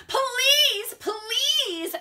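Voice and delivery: high, drawn-out begging; pleading. Acted. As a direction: beg mom, stretching each word. Andrew begging his mom.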